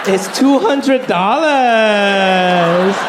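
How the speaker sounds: slow triumphant reveal, lightly voiced mom; proud mom, drawn out. Mom proudly reveals the cheap price, slow and smug. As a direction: savor the bargain slowly. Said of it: "she was like".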